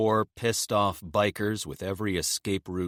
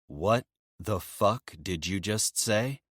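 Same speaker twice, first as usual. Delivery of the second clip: dangerous; 3 semitones higher than usual. Acted. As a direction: measure each word like a threat. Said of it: "Emil's voice is dangerous now".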